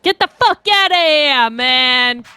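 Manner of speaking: loud, high New York brush-off; New York accent. Shown as a demonstration. Showing a co-player how to say the line, loud New York accent. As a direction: yell it like an irate New Yorker. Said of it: "you gotta say it like a New York".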